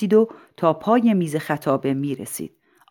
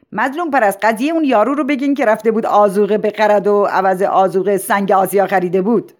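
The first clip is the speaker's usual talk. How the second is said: raised voice, urging him on; insistent prompting; 4 semitones higher, 5 dB louder than usual. Acted. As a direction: prompt him loudly with a funny anecdote. Principal prompts silent speaker aloud. (ترغیب با صدای بلند)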